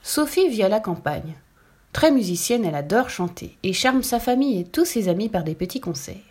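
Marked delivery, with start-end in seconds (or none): none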